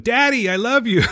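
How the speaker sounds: high little creature voice; a high, eager creature's coo. Retold or imitated. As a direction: squeak it like a tiny creature. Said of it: "new creations start talking to him".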